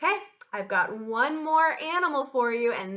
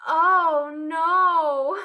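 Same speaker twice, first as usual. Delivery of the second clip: higher, louder drawn-out dismay; mock dismay; 5 semitones higher, 8 dB louder than usual. Teacher reacting to a funny smell for children, mock dismay. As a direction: groan it out as if a stink hit you.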